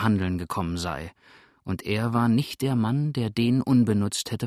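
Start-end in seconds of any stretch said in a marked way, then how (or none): none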